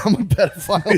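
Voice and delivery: high, loud laughing blurt; cracking up at your own line; laughing self-mockery. Joking about himself, laughing.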